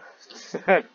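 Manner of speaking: a short burst of laughter, loud and high; real laugh. Real, not acted. Reader laughs at his own rambling, a real loud laugh.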